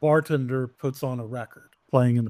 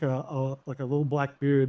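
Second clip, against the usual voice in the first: ordinary talk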